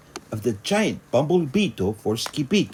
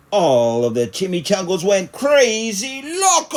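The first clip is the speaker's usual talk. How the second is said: higher, louder, gleeful read; excited narration; 9 semitones higher, 6 dB louder than usual. A narrator's reading. Narrator telling the party, excited and higher. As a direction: let the excitement run away.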